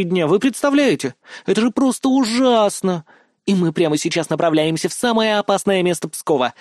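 unnatural, over-dramatic vlog announcement; affected blogger voice. A narrator's reading. Blogger vowing vengeance on villains for her viewers, affected and theatrical. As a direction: ham it up for the camera like a sensational host. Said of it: "Мне это резало слух, выглядело неестественным".

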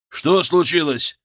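high, loud, drawn-out question; urgent call. A narrator's reading. Doctor running up to collapsed man, urgent call. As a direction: call it out as you arrive. Colleague runs up to emergency.

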